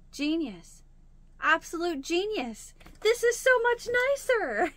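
a squeal of delight, way up high; thrilled discovery. Retold or imitated. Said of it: "and I'm like".

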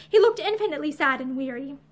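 usual speaking voice

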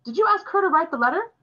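father sharply confronting his son. A narrator's reading. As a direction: fire the question at the boy. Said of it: "Father asked sharply".

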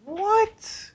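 shocked disbelief. Real, not acted. Shocked at the condom-poking story.

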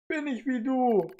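hoch und langsam; entsetzte Erkenntnis. Acted. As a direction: fassungslos fragen. (entsetztes Fragen)

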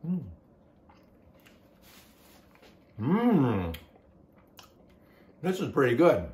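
talking with food in mouth; chewing. Real, not acted. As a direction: mouth partly full. Seen 0: bites at -4, jaw working, lips closed until about 5s.

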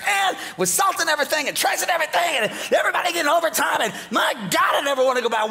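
shouted, fast, worked-up complaint; preacher venting at full volume; exasperated rant. Acted. Preacher ranting about snow costs.

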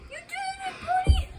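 high, bright praise for the dog; excited praise. Real, not acted. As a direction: cheer him on, way up high. Praising the dog after its run.

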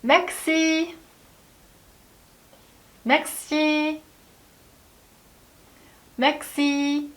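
teacher models 맥시, waits for the class; model pronunciation for repeating. Shown as a demonstration. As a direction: demo 맥시 with gaps to repeat after. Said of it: "한번 먼저 따라해볼까요".

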